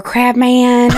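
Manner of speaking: sweet-talking Georgia drawl; Georgia accent. Shown as a demonstration. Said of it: "talking like she was from Georgia".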